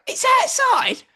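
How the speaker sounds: high incredulous repeat; you can't be serious; disbelieving echo. Retold or imitated. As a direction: friend stunned the van is outside. Friend in disbelief.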